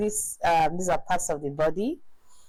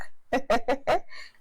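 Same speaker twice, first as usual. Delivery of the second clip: amused laugh; 6 semitones higher than usual. Real, not acted. Laughing at picture.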